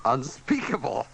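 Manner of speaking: ordinary talk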